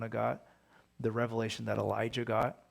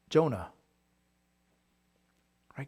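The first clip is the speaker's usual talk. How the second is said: usual speaking voice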